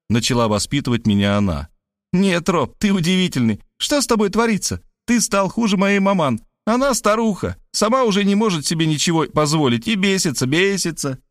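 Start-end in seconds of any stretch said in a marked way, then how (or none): 2.1-11.2 put-on high scolding girl voice; lecturing Varvara. A narrator's reading. Varvara lecturing Rob about her mother, high. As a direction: lecture him, high and indignant.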